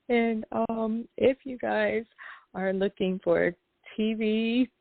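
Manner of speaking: ordinary talk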